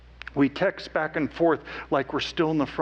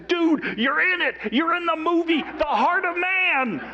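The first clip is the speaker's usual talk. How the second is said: much higher, louder, calling out as if across the street; excited yell; 3 dB louder than usual. Retold or imitated. Mark yelling to his friend that he's in the movie, loud excited call. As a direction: shout it joyfully to a friend far away. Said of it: "Mark yells at me like I'm across the street".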